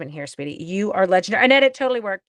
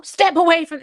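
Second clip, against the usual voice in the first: mock command; 9 semitones higher, 11 dB louder than usual. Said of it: "is that what you're telling me?".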